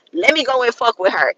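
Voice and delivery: ordinary talk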